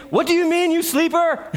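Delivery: a ship captain scolding a sleeper; annoyed captain. Retold or imitated. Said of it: "So the captain came and said to him".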